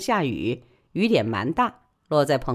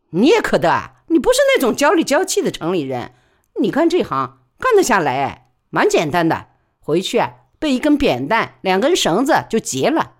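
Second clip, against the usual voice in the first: loud frank porter woman gossiping; He Sao's voice; 3 semitones higher, 5 dB louder than usual. Acted. He Sao encourages.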